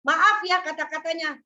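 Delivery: ordinary delivery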